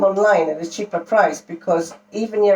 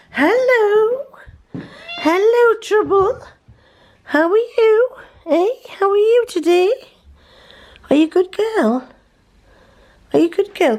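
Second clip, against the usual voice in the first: much higher, sing-song pet voice. Real, not acted. Owner greeting his cat in high baby talk.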